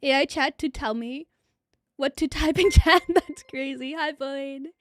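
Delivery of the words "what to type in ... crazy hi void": usual speaking voice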